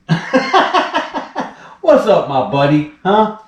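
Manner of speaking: high, laughing voice to a pet. Real, not acted. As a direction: giggle while greeting him. Laughing greeting to the snake.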